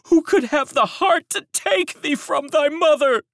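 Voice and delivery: high, loud grieving woman; lamenting servant. A narrator's reading. Servant at the fountain grieves aloud, high and loud. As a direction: cry out the question in sorrow.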